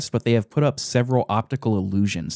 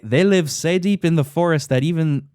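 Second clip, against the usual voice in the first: put-on higher-pitched amateur Scottish dwarf accent; game attempt at a Scottish brogue; 6 semitones higher than usual. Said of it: "Very good attempt at a Scottish accent".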